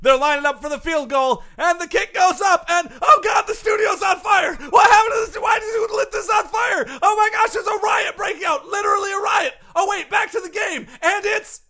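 shouting, escalating panic play-by-play; frantic announcer. Acted. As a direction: announce the chaos at full yell.

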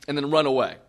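ordinary talk, nothing marked

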